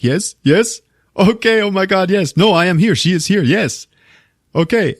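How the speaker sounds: high, mock-excited rapid agreement; excited phone reply. Writer on the phone with his publisher, acted excited. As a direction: imitate an eager man answering a call.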